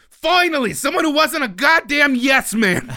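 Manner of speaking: loud, high Brooklyn growl; Brooklyn accent. Retold or imitated. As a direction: shout it like a gruff Brooklyn boss. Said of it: "in his thick Brooklyn accent".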